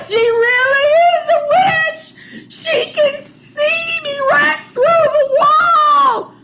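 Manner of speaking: very high, loud panicked screaming; terrified shriek. Acted. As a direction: shriek and bolt. Robber flees in terror.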